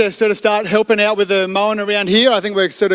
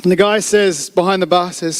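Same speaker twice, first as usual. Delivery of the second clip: normal talking voice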